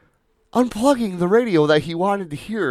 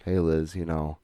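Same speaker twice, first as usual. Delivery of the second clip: quiet version; 11 semitones lower, 8 dB quieter than usual. Retold or imitated. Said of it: "so you're like".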